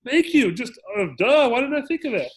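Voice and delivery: high, loud mock gratitude; over-the-top fake thankfulness, pitched high; sarcastic thanks. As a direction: gush sarcastic thanks at him. Sarcastic quote of his reaction.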